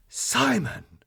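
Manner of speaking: gasp, then high shocked cry; high-pitched, aghast; thunderstruck. Acted.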